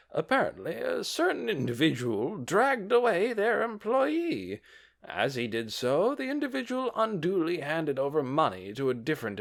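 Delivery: high, pompous official. A narrator's reading. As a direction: lecture them like a self-important official. Official Stefan accuses.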